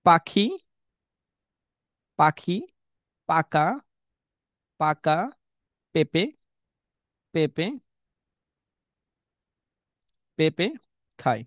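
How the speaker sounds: each word said slowly, one by one; slow syllable demo. Shown as a demonstration. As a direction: slowly spell out পাখি পাকা পেঁপে খায় word by word. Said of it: "আমরা বলেছি না?".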